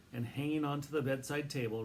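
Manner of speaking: ordinary delivery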